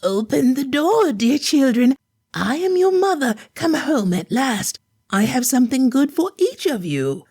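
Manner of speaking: wolf faking the mother's voice; wolf's put-on soft voice. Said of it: "He said in a soft voice".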